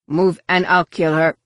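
loud, forceful warning; forceful threat. A narrator's reading. Hostage taker to stunned Jedi Knights, loud threat. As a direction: warn them off with force.

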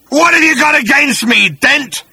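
high, loud monster snarl; snarling creature. Acted. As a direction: snarl the question with menace. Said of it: "snarled the creature".

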